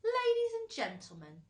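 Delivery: high, loud formal address; old grasshopper addressing all. A narrator's reading. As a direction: announce it bravely to the crowd. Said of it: "trying very hard to keep the fear".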